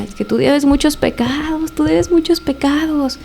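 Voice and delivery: high, slow, drawn-out taunt; retold possessed taunt, stretched and high; taunting accusation. Imitating possessed man accusing mother.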